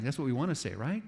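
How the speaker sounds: normal talking voice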